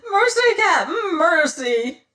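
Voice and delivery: high, loud terrified wail; crying for mercy. Acted. Fat pirate begging the captain for mercy, a terrified squeal. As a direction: beg in a pitiful squeal. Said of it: "cried Spraggins".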